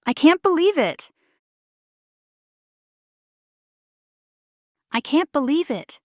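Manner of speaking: high-pitched astonishment; surprised disbelief. Shown as a demonstration. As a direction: make the pitch jump, then fall. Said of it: "let's explore surprise".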